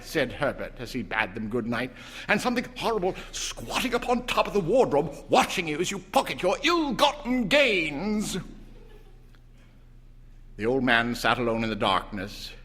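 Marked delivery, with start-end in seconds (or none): 2.3-8.4 higher, fast mock-sinister son; mock spooky tease. A narrator's reading. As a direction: spook them in jest. Herbert teasing spooky.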